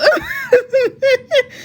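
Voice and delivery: real burst of very high laughter; high-pitched laugh. Real, not acted. Teller cracking up over mother's texts, laughing.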